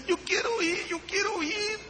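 put-on high, soft pleading child's whimper; crying children. Youth leader retells crying kids begging to go, high soft whimper. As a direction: imitate kids begging through tears, high and hushed. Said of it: "y lloran, y me dicen".

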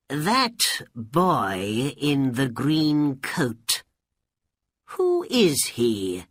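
put-on slow, deliberate old man's voice; inquiring old man. A narrator's reading. Old man asking an orange seller about a boy, slowly and deliberately. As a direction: draw the question out with intent.

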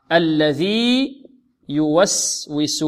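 الذي يوسوس slowly with the two ي kept separate; slow الذي held before يوسوس; clear ياء. Shown as a demonstration. Teacher shows the clear ياء in Surat an-Nas. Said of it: "لا بد من إظهارها".

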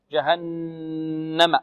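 "جهنم" said very slowly, with a hard, stopped ج; firm jim. Shown as a demonstration. Teacher models the hard jim in "جهنم" slowly. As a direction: stress the hard stop on the ج of "جهنم". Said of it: "الجيم شديدة".

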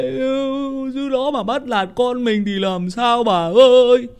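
put-on high, loud, slow gossiping old woman railing at the in-law; fretting old mother. Old mother tells her husband the in-law bullies their girl, high, loud and slow. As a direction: wail it like a worried granny slagging off her daughter's mother-in-law. Old parents fret over married daughter.

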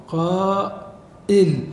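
"قائل" very slow, long "qaa", pause, then "il"; hamza pronunciation. Shown as a demonstration. Teacher showing what Arabs say, "قائل" split as qaa-'il. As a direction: stretch "قائل" and break before the hamza. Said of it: "فإن العرب تقول".

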